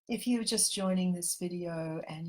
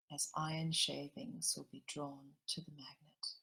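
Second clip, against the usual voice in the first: gentle meditation guide drawing everyone into the heart; guided meditation; 4 dB quieter than usual. Acted. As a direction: ease the listeners into calm, unhurried.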